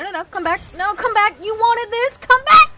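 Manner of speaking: loud, high jeering call after a fleeing enemy; taunting call. Real, not acted. Streamer jeering at a fleeing enemy player, loud and high. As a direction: yell at them to come back, mocking.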